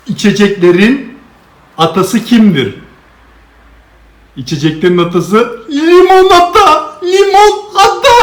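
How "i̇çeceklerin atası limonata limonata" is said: higher, laughing through the punchline. Acted. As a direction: crack up on the answer. Punchline, laughing while saying.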